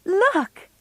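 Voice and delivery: high excited call; bright, eager and high. A narrator's reading. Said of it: "said Meg excitedly".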